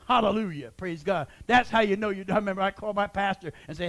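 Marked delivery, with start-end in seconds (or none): none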